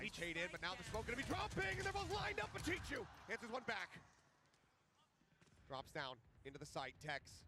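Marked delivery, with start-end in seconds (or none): 0.0-4.0 rising hype call; esports caster. Real, not acted. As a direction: build excitement on the kill.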